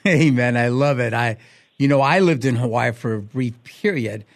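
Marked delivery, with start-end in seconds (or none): none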